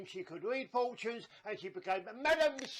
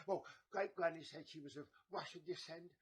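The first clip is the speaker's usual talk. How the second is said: ordinary talk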